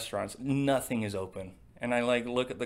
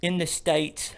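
slightly higher mock English accent; clipped English parody; 5 semitones higher, 5 dB louder, slower than usual. Echoing the Google fact in mock English accent. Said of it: "our terrible English accents".

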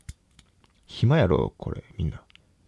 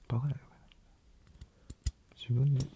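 whispered self-mockery; 5 semitones higher, 3 dB quieter than usual. Real, not acted. Self-mocking whisper, scared.

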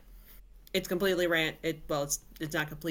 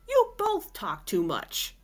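high, slow, exasperated snap; cursing; 10 semitones higher, slower than usual. A narrator's reading. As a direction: spit it out at them, fed up. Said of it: "cursed Nola".

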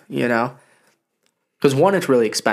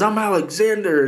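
mock Tyson rant; 9 semitones higher than usual. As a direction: rant it in a squeaky put-on voice. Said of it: "He goes".

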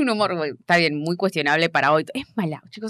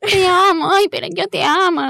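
hammed-up pleading in a high voice; imitating the actress; 12 semitones higher than usual. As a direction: mimic her melodramatic outburst. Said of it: "Cuando, cuando ella le dice".

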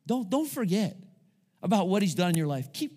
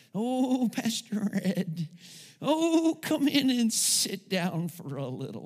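put-on higher, slow, frail old lady welcoming him; old lady's voice; 4 semitones higher, slower than usual. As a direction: stretch out "Pastor Ed" like a gentle elderly lady. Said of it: "That's how she talked".

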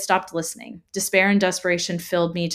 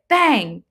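loud bang; 5 semitones higher, 6 dB louder than usual. A narrator's reading. Sound effect in horror story.

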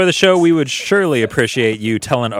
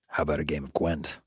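low, soft growling gravel of a witcher; Geralt voice; 7 semitones lower, 13 dB quieter than usual. Acted. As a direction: growl it low like a weary monster hunter. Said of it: "the Geralt voice is very similar to the Snake voice".

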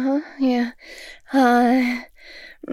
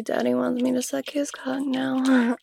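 softer unvoiced aside whisper; 5 dB quieter than usual. Telling the others in a whisper. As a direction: drop into a hushed aside.

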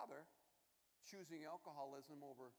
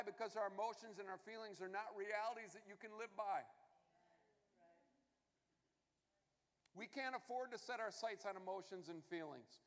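higher and louder, driving each point home; full-force preaching; 6 semitones higher, 7 dB louder than usual. Acted. Pastor preaching hard to his congregation, fervent and loud. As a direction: preach it out, building to the rhetorical questions.

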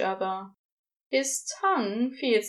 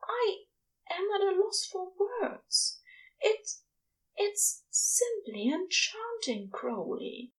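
higher, soft, wonder-struck angel voice; awed angel; 11 semitones higher, 3 dB quieter than usual. Acted. Angel marvels at Crowley's nebula, higher and awed. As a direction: breathe it out like he's seeing heaven anew.

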